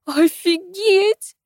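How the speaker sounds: put-on very high, drawn-out girl's squeal. A narrator's reading. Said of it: "визжала девочка". (протяжный девчачий визг)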